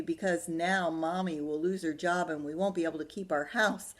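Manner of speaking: loud, high dramatic doom; melodramatic D W. Acted. Little sister blaming Arthur for ruining everything, loud and dramatic. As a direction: pile on the drama, blaming him.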